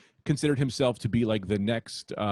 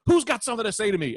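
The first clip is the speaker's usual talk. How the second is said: retold yell; 8 semitones higher, faster than usual. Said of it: "he literally yells at the crowd".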